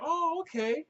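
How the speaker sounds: a breezy, dismissive "oh, okay" from the clerk; mock clerk voice. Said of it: "And she's like".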